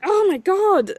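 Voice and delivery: high, loud shocked Oh my God; imitating her own overwhelmed reaction; dramatic gasp. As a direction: burst out in shocked disbelief. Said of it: "like this".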